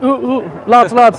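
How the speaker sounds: loud laddish hollering; rowdy lads calling. Retold or imitated. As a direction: call out like a rowdy lad. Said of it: "They're all like".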